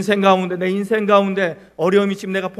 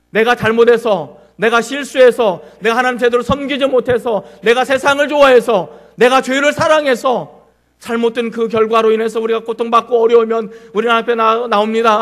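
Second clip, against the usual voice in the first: louder, building preacher's cadence; fervent preaching; 4 semitones higher, 7 dB louder than usual. Acted. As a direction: preach it with rising force to the congregation. Preaching with rising fervour. (점점 힘차게 설교하듯)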